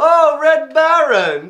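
put-on high, loud, drawn-out German-accented greeting; big slow exclamation in a German accent; hearty greeting. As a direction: hail an arriving guest grandly. Kaiser greets arriving Red Baron.

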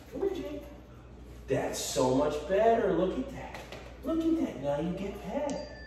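high, sing-song dog-praise voice; squeaky praising a dog. Real, not acted. Trainer praises dog after jump.

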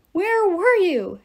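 high, loud, slow demand; angry at the late coach, demanding. A narrator's reading. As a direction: hold each word and demand it. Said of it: "Jason demanded".

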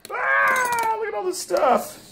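ordinary talk, nothing marked

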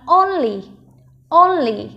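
"only" stressed on "on", falling on "ly"; first-syllable stress. Shown as a demonstration. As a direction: hit the first syllable of "only", let the second drop. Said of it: "Trọng âm rơi vào âm đầu tiên".

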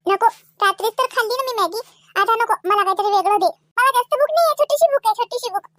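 lightly voiced child pleading; picky hungry child. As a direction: wheedle a little snack from auntie. Hungry child asks for snack.

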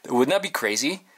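ordinary talk

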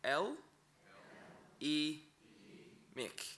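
"el, i, mik" split into separate syllables with pauses. Shown as a demonstration. Teacher breaking down "el, i, mik" for the class. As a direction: sound out "el, i, mik" one syllable at a time. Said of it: "So this one is".

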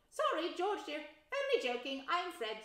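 put-on high, loud jokey boy; teasing twin. Twin teasing his mother, high and playful. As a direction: pull her leg playfully.

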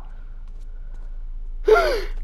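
sharp frightened intake of breath; scared gasp. Real, not acted. Ghost appears in game.

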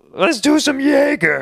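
high, slow, rowdy fan voice; lightly hammed-up bro shouting for shots; rowdy drunk fan. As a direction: do a pushy drunk fan begging to party. Imitating maniac fans.

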